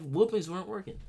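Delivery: ordinary talk, nothing marked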